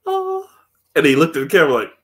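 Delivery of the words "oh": mock surprised oh. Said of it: "she's like".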